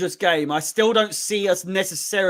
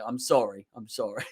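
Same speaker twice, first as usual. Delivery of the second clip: sheepish apology; 8 semitones lower, 9 dB quieter than usual. Real, not acted. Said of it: "I just get a little bit overexcited".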